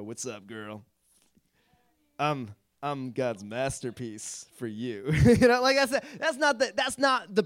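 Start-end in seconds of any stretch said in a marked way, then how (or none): 0.0-5.1 mock a guy hitting on a girl; cheesy pickup line. Guy using the verse to hit on a girl, low mock smooth line. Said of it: "walk around to every girl we meet and go".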